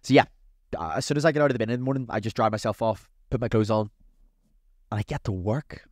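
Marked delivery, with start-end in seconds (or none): none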